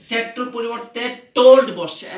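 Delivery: teacher stressing the key change; emphatic. Shown as a demonstration. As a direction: hit told hard. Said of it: "emphasising told".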